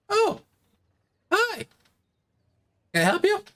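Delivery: very high little-gentleman voice; halfling shopkeeper. Acted. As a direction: pipe up as a small shopkeeper. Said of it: "halfling gentleman kinda pops out".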